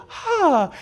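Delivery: high squeal of delight; a baby surprised by the ball, high "Ha!"; infant delight. Retold or imitated. Preacher voicing an infant who sees the ball: delighted squeal. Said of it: "they go".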